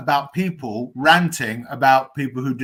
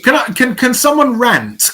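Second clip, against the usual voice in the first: excited; 10 semitones higher, 5 dB louder than usual. Real, not acted. Eager request to rant.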